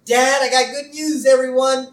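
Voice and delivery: high, girlish cartoon voice; high girlish Lisa impression. Voicing Lisa retelling episode.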